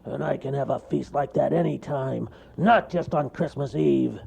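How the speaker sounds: a touch high and loud bear voice; boasting bear. Acted. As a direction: repeat the boast. Bear repeats his boast.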